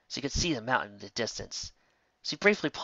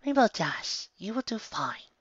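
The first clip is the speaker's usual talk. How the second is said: Rarity's voice; 10 semitones higher than usual. A narrator's reading. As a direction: reassure her gently as Rarity. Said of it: "Rarity said".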